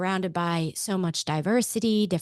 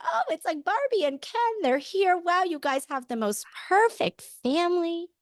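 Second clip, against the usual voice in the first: much higher, mock-admiring voice; gushing churchgoers; 9 semitones higher than usual. Churchgoers greet the perfect family, gushing in high voices. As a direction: imitate people gushing over a picture-perfect family.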